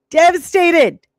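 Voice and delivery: high, loud, breaking repeat of Devastated; devastated cry. Real, not acted. Telling the audience about the headline, a loud anguished cry. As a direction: cry out Devastated as the hurt hits. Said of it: "I was devastated".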